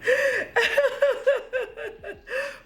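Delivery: high wailing laugh; helpless high cackle; shrieking laugh. Real, not acted. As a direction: crack up in a squeal. Laughs at naming the look.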